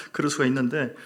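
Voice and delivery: ordinary delivery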